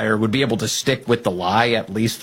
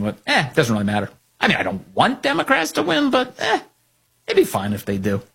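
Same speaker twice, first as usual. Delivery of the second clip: mock shrugging Cornyn; 4 semitones higher than usual. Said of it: "another way of Cornyn saying".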